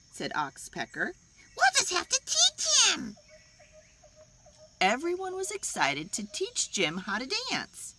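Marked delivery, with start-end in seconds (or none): 1.6-3.2 chirpy bird voice. Acted. Oxpecker cheerful.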